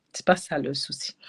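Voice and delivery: chuchoté en aparté. Real, not acted. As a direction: glisser la remarque à voix basse. (murmure d'aparté)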